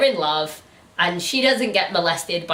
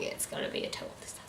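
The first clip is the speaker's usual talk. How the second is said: fast, much softer whispered aside; 18 dB quieter, much faster than usual. Real, not acted. Signing off the video, whispering a gloomy prediction. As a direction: mutter it under your breath.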